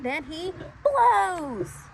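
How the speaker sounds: very slow, loud build-up; dramatic build. A narrator's reading. Narrator builds up to Tiny blowing eggs, slow and loud. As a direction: stretch it out for the big blow.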